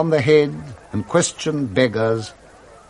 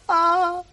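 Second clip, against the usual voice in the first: a long, delighted high laugh; Scrooge's first laugh in years, high and gleeful; splendid high laugh. Acted. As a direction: laugh like you've forgotten how and just remembered. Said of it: "it was a splendid laugh".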